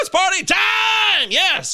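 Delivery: party shout. Real, not acted. Hyped celebration.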